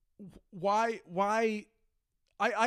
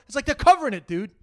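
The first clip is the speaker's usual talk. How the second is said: exasperated rant; 7 semitones higher, 11 dB louder than usual. Real, not acted. Ranting at the president.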